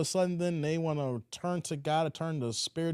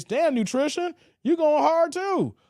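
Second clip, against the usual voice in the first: higher, louder, slow exclaim; mock shock at the comment; amused surprise; 8 semitones higher, 5 dB louder, slower than usual. Real, not acted. Host reacts to harsh comment.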